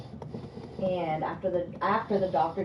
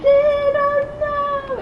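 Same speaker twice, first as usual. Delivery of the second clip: much higher, slow crying wail; drunken wail; 9 dB louder, much slower than usual. Retold or imitated. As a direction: wail it out, sobbing and lost. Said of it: "And I'm like".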